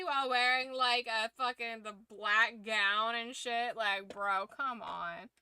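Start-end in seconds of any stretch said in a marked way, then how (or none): none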